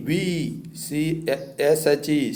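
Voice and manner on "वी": वी softly as English we. Shown as a demonstration. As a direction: model वी after spelling it. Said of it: "डब्ल्यू ई".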